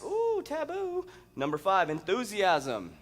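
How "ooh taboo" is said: high, drawn-out teasing ooh; slow, high-pitched mock shock; mock scandalized. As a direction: play shocked at a naughty word, pitched way up. Mock reaction to the word sex.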